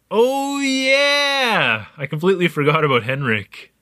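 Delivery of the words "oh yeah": sudden realization. Acted. Suddenly remembers the diary.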